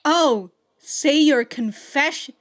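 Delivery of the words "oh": usual speaking voice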